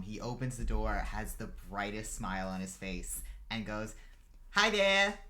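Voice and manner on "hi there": put-on high, loud little-kid greeting; five-year-old boy. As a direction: chirp it like an eager small boy. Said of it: "he's probably about five years old".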